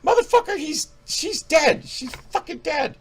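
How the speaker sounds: very high, squealed mock outrage; exasperated rant. Reviewer scolding the grieving film character, exasperated. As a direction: yell back in an incredulous squeak.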